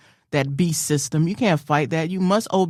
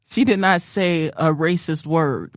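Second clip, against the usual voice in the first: usual speaking voice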